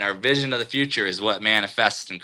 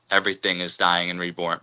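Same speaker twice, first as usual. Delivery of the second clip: usual speaking voice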